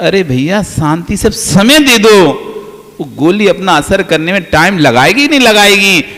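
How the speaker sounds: ordinary talk, nothing marked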